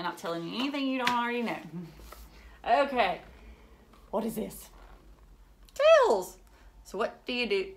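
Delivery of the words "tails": high, loud, thrilled; excited announcement. Acted. Teacher introducing tails to kids, high and loud. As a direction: announce it like a treat.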